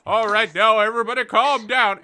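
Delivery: high, loud shout trying to restore order; panicked shout. Retold or imitated. Old rabbit leader shouting to the panicked warren, high and loud. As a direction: yell for calm while panicking yourself. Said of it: "The Greyfur is like".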